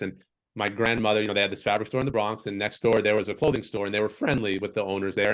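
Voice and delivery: ordinary talk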